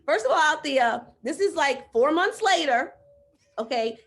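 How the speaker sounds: loud, high, incredulous; pushing back at her coach; exasperated protest. Retold or imitated. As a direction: argue back in disbelief. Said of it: "I'm like".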